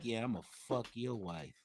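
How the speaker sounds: low, quiet voice of a leering senator. Acted. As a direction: murmur it low, as a man eyeing another's wife. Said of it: "was looking at my wife like".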